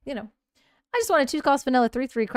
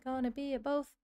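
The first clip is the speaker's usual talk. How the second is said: soft sing-song; 3 semitones higher, 8 dB quieter than usual. Shown as a demonstration. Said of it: "repeats the phrase".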